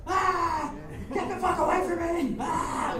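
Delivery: high, loud freak-out shriek; mock screaming. Shirtless skinhead screaming at the crowd, mock freak-out scream. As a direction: act out a guy screaming wildly to be left alone. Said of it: "he's screaming at all this".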